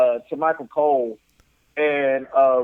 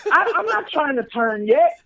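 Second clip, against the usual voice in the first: high, stammered reluctance; mock nervousness; 8 semitones higher than usual. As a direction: imitate a rattled wrestler. Voicing Finn's nervous reaction.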